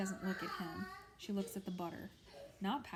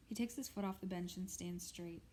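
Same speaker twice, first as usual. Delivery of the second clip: ordinary delivery, nothing marked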